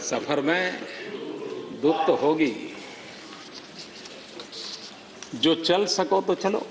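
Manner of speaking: measured ghazal recital with long pauses; poem recitation. Acted. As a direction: recite the couplets line by line to an audience.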